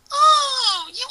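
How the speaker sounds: put-on high, flustered girlish cry; venting Amy. Amy scolding Sonic after the pillow throw, high cry. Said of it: "she vented".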